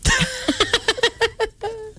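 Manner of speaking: high laugh. Real, not acted. Laugh after a pause.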